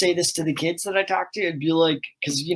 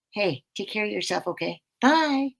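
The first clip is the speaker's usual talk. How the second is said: soft, gentle cartoon bear voice, softer; tender cartoon bear saying goodbye to kids; Tenderheart; 3 semitones higher, 5 dB quieter than usual. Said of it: "from Tenderheart".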